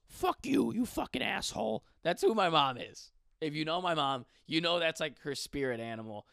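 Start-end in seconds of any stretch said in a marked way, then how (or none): 0.1-1.8 high Marge Simpson-like mom cursing; mock cursing mother; sweary mom. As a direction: swear in a pitched-up cartoon mother voice.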